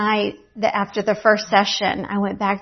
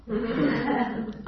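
a real delighted chuckle; amused little laugh; short laugh; 5 dB quieter than usual. Real, not acted. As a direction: laugh it off lightly. Laughs at her own surprise.

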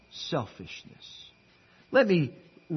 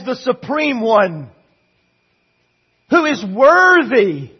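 loud, emphatic pulpit delivery; forceful preaching; 8 semitones higher, 13 dB louder, slower than usual. Acted. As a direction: build each phrase with weight, stretching key words.

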